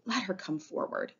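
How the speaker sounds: usual speaking voice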